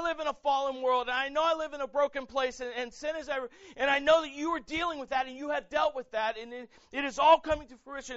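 high, shouted declaration of praise; fervent, loud prayer shouted from the pulpit; full-force preaching. Acted. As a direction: preach it at the top of your voice, phrase upon phrase.

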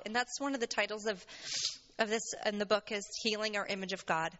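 usual speaking voice

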